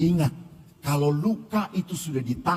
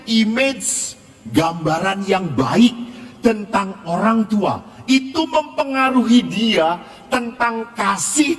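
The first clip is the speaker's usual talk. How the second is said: raised, insistent preaching voice, higher than his storytelling; 7 semitones higher, 8 dB louder than usual. Acted. Priest urging his congregation, preaching at full force. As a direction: drive the lesson home to the congregation.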